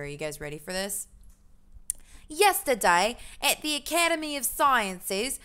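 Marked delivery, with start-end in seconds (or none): 2.3-5.4 hammed-up British accent, pitched higher; grand, badly faked British newsreader voice; awful British accent. Streamer reading a game newspaper to viewers, awful British accent. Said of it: "most awful British accent".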